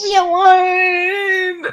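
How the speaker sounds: high, drawn-out plaintive whine; playful, whimpering protest; mock wail. Teased about accent that breaks her.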